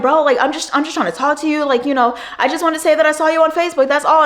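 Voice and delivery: mock his pushy pleading; a creepy coworker pleading he only wants to talk; whiny Chris.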